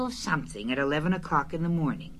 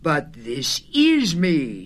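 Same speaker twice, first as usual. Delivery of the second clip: slow, louder astonished protest; very surprised; 6 dB louder, much slower than usual. A narrator's reading. Pooh learning he is being visited, very surprised. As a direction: lean into the words, taken aback. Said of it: "very much surprised".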